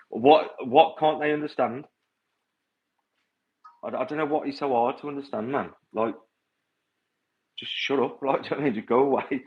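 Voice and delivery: exasperated. Real, not acted. Exasperated rant at Karens.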